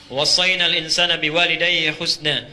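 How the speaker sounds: chanted Arabic verse, full voice; Quran recitation. Acted. Preacher reciting Quran to congregation, chanted.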